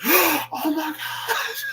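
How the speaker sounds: high, slow, drawn-out gasp of shock; acted awe. Caught up in the movie again, acted awe. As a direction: squeal it slowly, as if overwhelmed.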